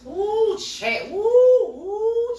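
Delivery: high, loud wailing "woo" cries with "chat" between; anguished woo cries. Real, not acted. Vlogger telling viewers how lonely she was, loud high wailing. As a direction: cry out the pain like a wail. Reliving despair, crying out.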